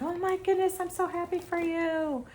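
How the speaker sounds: high, excited imitation of mom; imitating mom. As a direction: squeal it like a thrilled mom. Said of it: "she's like".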